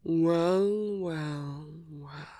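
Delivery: long, drawn-out words fading away; teasing greeting. Acted. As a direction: draw each one out, knowingly. Teasing greeting to the arriving hero.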